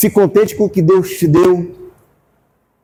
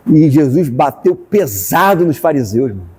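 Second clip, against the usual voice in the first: usual speaking voice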